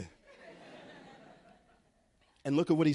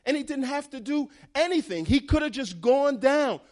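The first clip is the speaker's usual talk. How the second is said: higher and louder, building up; rising preaching excitement; 6 semitones higher, 3 dB louder than usual. Real, not acted. A preacher marvels at the ram God provided, rising with excitement. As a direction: lift the congregation with the joy of it.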